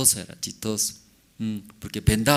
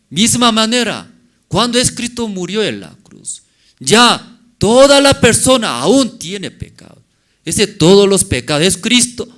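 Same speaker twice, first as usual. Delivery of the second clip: loud, high preaching voice; fervent preaching; 8 semitones higher, 9 dB louder than usual. Acted. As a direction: drive the message home to the congregation. Preaching at full force. (predicador que exhorta a la congregación con fervor)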